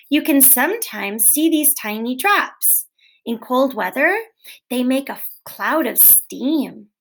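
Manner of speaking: plain reading voice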